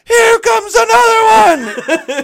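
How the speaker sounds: very high, loud, slow laughing cry; excited squeal. Retold or imitated. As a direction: shriek it like a thrilled fan. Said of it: "he goes".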